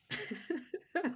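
a sudden high laugh, breaking up; high burst of laughter. Real, not acted. Telling a friend about a mortifying diagnosis, bursting into laughter.

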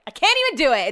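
can't-even outburst. Real, not acted. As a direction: throw up your hands at it.

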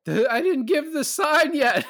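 high, loud mock scream; imitated scream. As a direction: screech it out, laughing, as an impression. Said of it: "I love how Aaron screams".

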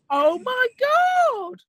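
real high, drawn-out amazement; stunned reaction to the reveal; astonished. Real, not acted. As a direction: stretch it out in high-pitched disbelief. Listener reacts to story's reveal.

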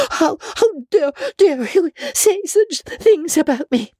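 high, stammering, tearful anger; vehement fury. A narrator's reading. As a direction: hurl the words back at her. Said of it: "she repeated vehemently".

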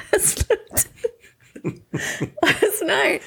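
half-crying laugh; laugh-crying over the words; laughing while teary. Real, not acted. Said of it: "She'll cry, then she'll laugh".

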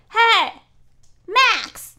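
loud, high call to the dog Max. Retold or imitated. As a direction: shout across the field for Max. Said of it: "escucho a alguien". (dueño llamando fuerte a su perro)

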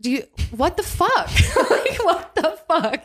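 high incredulous laughing; laughing disbelief. Retold or imitated. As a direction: laugh at how absurd it is. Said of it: "That I'm like".

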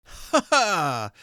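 a high, bright burst of laughter; hearty laugh. Acted. Nephew laughing heartily at Christmas party, high hearty laugh. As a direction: laugh it out from the belly. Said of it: "laughed Scrooge's nephew".

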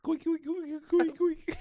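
high squeaky mouse voice; little mouse squeaks. Retold or imitated. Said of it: "You sound like a little mouse".

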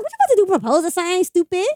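loud, high-pitched retold disbelief; incredulous. Girlfriend re-enacting her question to him in the car, incredulous. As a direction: blurt it high, as if you can't believe it.

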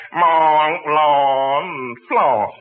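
nasal honk, squeezed through the nose; spoken through the nose. Shown as a demonstration. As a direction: push the word up into the nose like a mock Frenchman. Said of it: "French is spoke through the nose".

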